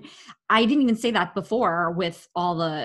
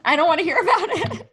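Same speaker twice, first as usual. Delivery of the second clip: high, louder, laughing brush-off; laughing dismissal; 8 semitones higher, 4 dB louder than usual. Real, not acted. Cohost laughing, refusing to dwell on breakup talk, high and amused. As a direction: laugh it off, wave the sad story away.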